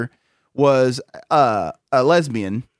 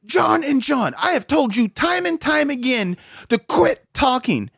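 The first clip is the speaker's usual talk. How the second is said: high, slow mock scolding yell; retold teacher's yell; 9 semitones higher, slower than usual. Teacher telling off two students in seminar, high mock yell. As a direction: imitate an exasperated teacher, pitched high and drawn out. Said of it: "just yells".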